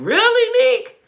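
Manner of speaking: high, loud incredulous question; surprised. Real, not acted. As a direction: react in real disbelief, pitch shooting up. Surprised reaction to a viewer's comment.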